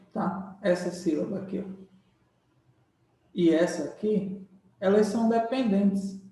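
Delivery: usual speaking voice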